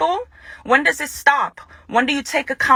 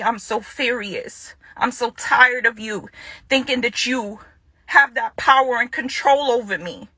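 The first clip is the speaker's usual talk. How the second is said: normal talking voice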